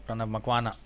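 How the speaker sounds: ordinary talk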